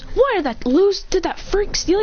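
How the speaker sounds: put-on high, indignant girl's voice; Sakura. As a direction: demand it in a high girlish voice. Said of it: "She goes".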